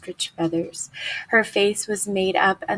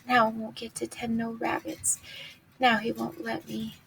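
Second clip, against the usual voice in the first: much softer, hushed and sorrowful; whispered; 3 semitones higher, 7 dB quieter than usual. A narrator's reading. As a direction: breathe it out under the breath. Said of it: "he whispered".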